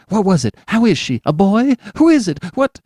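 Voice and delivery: high, loud tumbling questions; frantic father rushing up to the doctor; breathless rush. Acted. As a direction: gasp out question after question. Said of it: "came up in a gasping rush".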